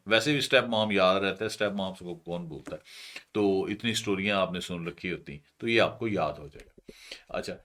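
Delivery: ordinary talk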